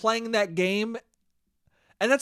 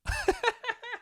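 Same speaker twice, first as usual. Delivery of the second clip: soft high laugh; 7 dB quieter than usual. Real, not acted. Laughing after mock interrogation.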